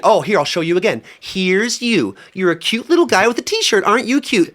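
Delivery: put-on high and loud, sugary baby-talk voice; high cutesy spirit voice. Spirit cooing at him about the coffin, high and loud. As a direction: coo at him like a cute pet, then turn it crude.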